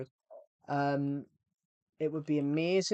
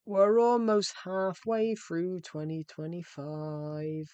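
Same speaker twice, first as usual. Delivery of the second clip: slow, drawn-out, higher announcement; slow dramatic; 4 semitones higher, 4 dB louder, much slower than usual. Pretend. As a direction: stretch each word out for drama. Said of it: "slow dramatic repeat".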